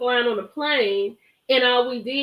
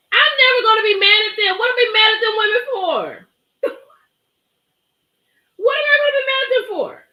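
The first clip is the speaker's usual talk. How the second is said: higher and louder, laughing insistence; emphatic disbelief; 10 semitones higher, 5 dB louder than usual. Real, not acted. Vlogger insisting to her live viewers, high and loud. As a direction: protest with a laugh in it.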